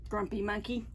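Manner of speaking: normal talking voice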